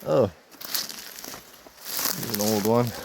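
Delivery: ordinary talk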